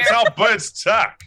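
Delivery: high, loud, slow mobster bird; gangster bird voice. Acted. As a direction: drawl it like a 1920s wiseguy bird. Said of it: "gangster from the nineteen twenties".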